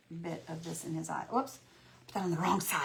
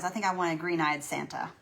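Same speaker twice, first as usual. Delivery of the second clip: ordinary delivery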